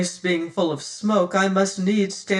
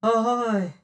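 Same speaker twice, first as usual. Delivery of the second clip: echo; 4 semitones higher than usual. Acted. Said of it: "back came the answer".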